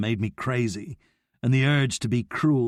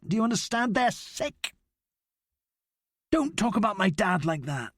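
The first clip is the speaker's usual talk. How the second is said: much higher, raging rant; furious outburst; 8 semitones higher than usual. A narrator's reading. Abdi raging at Noah about his family, much higher. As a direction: lash out, sick of him.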